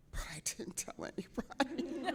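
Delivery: whispered secret; conspiratorial whisper. Real, not acted. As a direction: hush it like a confession. Secret vote confided.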